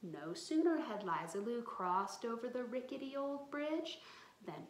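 usual speaking voice

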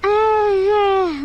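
long loud yawn. Real, not acted. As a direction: yawn through the sound. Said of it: "Was she yawning?".

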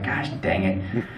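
soft, slow, mildly annoyed grumble; pissed grumble. Retold or imitated. Teammate grumbling at Tana's trouble, a mild pissed mutter. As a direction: mutter it under your breath, mildly pissed. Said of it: "'Cause he's pissed".

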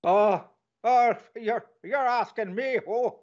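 hammed-up Irish brogue, teasing the quiz; bad Irish brogue. Cohost answering the quiz in a bad Irish brogue. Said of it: "trying to do an Irish brogue".

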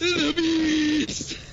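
high shriek of the bees. Acted. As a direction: yell it in mock terror. Bee scream again.

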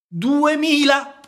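Duemila? stretched out very high and loud; a greedy cat marvelling at the sum; cat's amazed echo. Acted. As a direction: squeal Duemila? slowly, wide-eyed. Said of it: "ripeté il gatto".

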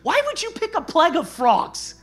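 high, incredulous wondering; mock bafflement at the frogs; puzzled question. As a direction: voice the baffled reader.

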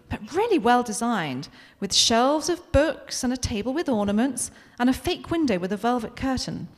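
plain reading voice

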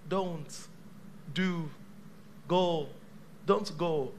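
soft, word by word; still small voice. Acted. God speaking in your heart, quiet and unhurried. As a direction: gently place each word with long gaps. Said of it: "God is in that still small voice".

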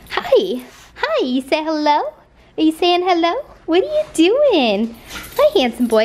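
high sing-song pet voice; talking to a dog. Real, not acted. Greeting her dog.

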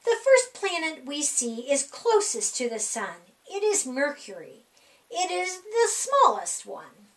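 ordinary delivery

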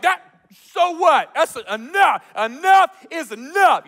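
loud, high defiant cry; pumped up. Retold or imitated. Gideon facing the Midianite army, pumped up. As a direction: throw it out fired up and emphatic. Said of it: "he's so pumped up".

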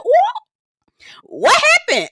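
very high, loud squeal of surprise; shocked. Retold or imitated. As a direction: reenact being caught off guard, squealing. Retells her shocked reaction.

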